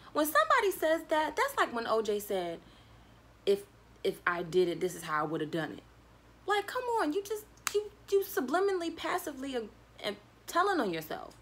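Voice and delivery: high, loud incredulous rant; worked-up come-on-now rant; exasperated disbelief. Real, not acted. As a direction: call out the obvious lie. Mocking suspect's denial.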